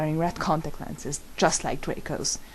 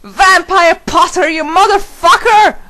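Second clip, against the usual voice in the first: yelling; 13 semitones higher, 13 dB louder than usual. A narrator's reading. Narrator storms into class yelling at Vampire. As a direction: drag out the curse at full blast. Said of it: "I yelled".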